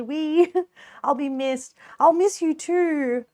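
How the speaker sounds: normal talking voice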